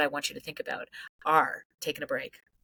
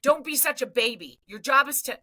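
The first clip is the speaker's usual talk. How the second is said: much higher and louder, a mock dismissive rant; harsh scolding; 9 semitones higher, 4 dB louder than usual. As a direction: voice the coach still angry at the scared ref, high and loud. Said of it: "If my lid is still flipped".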